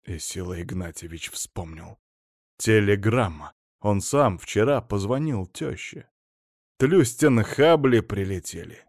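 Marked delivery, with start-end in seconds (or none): none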